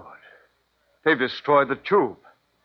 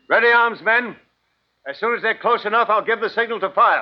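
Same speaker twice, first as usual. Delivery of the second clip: raised, higher orders to the troops; stern command; 6 semitones higher than usual. Acted. Colonel to his soldiers, raised firm orders. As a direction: get them ready to fire.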